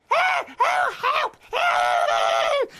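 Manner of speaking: very high, loud cry for help; panicked squeak. A narrator's reading. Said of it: "squeaked Little Mouse".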